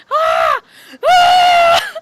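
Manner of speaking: high panicked screams; falling bird shrieking in panic; freaking out. Acted. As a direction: shriek in terror, twice. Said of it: "so that you're freaking out like".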